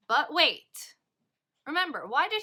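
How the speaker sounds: sudden loud "but wait"; excited exclamation. Real, not acted. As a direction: stop the class short with a loud exclamation. Teacher dramatic interruption.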